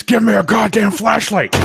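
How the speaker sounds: high, loud mock demand; imitating the streamer. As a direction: bark it like the streamer. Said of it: "every five minutes".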